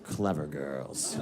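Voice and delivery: low, slow wary admiration; Jurassic Park hunter. Retold or imitated. Cornered man to the girls like raptors, low slow awe. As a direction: draw it out deep and slow.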